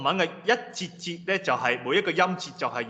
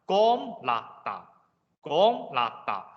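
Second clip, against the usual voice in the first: 光辣達 slowly, long heavy 光, short 辣 and 達; stressed O; much slower than usual. Shown as a demonstration. Teacher modelling Russian for room, 光 stressed and slow. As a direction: stretch 光 in 光辣達, keep each syllable separate. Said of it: "有重音就讀O".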